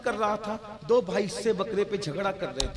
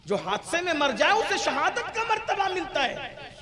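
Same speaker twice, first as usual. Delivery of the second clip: loud, high-pitched sermon at full force; fiery preaching; 6 semitones higher, 7 dB louder than usual. Acted. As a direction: hammer the point home to the crowd. (جوشیلی تقریر، بلند آواز)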